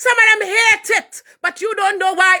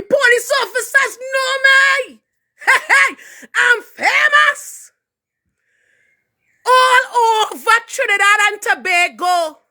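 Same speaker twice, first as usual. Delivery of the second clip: tearful bitter shout; 5 semitones higher than usual. Real, not acted.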